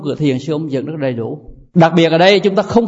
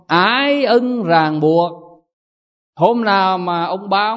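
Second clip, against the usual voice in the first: ordinary talk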